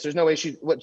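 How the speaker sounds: normal talking voice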